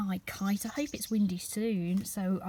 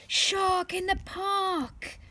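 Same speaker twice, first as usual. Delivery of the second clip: higher, slow, drawn-out shout; big mock shout; 9 semitones higher, 3 dB louder, slower than usual. Tim shouts the warning, drawn out high. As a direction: call it out fit to burst. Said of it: "That's what he shouts".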